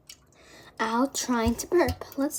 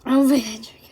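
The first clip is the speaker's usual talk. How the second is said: whispering; 12 dB louder, much faster than usual. Real, not acted. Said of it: "my stomach hurts so bad".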